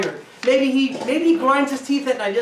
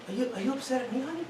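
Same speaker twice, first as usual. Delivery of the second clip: very soft, caring question; soft concern; 12 dB quieter, faster than usual. Acted. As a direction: ask her gently. Husband asks softly.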